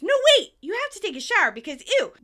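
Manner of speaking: loud, high urgent stop; Phoebe shouting. Retold or imitated. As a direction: yell to halt them, then rattle off the order. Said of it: "Phoebe shouts".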